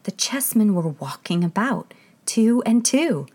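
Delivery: ordinary delivery, nothing marked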